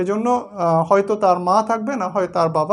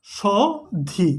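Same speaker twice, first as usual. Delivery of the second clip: সধি stretched out very slowly for the class; slow teacher demo; much slower than usual. Shown as a demonstration. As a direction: draw out সধি syllable by syllable. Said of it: "যে".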